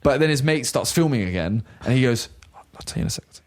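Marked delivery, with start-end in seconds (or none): 2.5-3.4 hushed, almost whispered; soft, voiceless confidential murmur; imitated secretive aside. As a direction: mimic someone putting off a secret. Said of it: "he's trying to tell me a secret".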